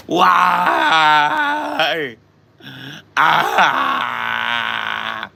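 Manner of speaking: loud, high drawn-out mock wail; wailing in anguish. Drunk friend on the couch in emotional pain, imitated anguished wailing. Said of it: "wailing in anguish".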